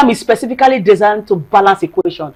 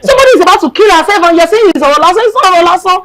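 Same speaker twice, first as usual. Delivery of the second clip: higher and fast, NOT pushed hard; indignant; 10 semitones higher, 4 dB louder, much faster than usual. Real, not acted. To family who dismiss abuse, raised indignant protest. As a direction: protest that abuse is no mere talk.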